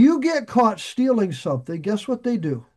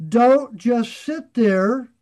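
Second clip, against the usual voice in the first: slow firm command; 3 semitones higher, 4 dB louder, much slower than usual. Acted. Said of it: "But he says".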